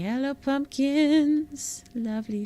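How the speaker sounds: high, slow, doting coo. Pretend. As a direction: greet them like a pet. Cooing at pumpkins in game.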